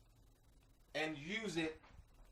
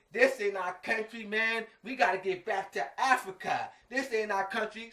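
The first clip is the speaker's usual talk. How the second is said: louder, higher mock Afrocentric declaring; hammed-up Afrocentric slogan, loud and pitched up; 6 semitones higher, 8 dB louder than usual.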